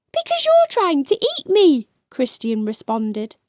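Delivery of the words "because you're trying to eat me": scared reply. Acted. Child answers the monster.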